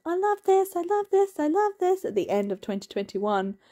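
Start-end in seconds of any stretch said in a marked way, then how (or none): 0.1-2.0 high, gushing fan voice repeated; mock gushing booktubers. Booktubers raving about a book, high and gushing. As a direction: imitate breathless fans raving. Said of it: "I got so many booktubers going".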